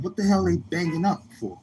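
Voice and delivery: high, puzzled question; hearing the neighbor's story, incredulous. Retold or imitated. Teller reacts to her story.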